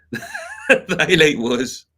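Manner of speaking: slowed down, cracking up mid-phrase; real laughter swallowing the words; laughing. Real, not acted. Cracking up at own story.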